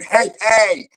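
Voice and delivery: high, loud greeting shout; loud hey hey opener. Retold or imitated. Comic opening his set with a shout. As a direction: grab the room with a big call. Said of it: "I start off by saying".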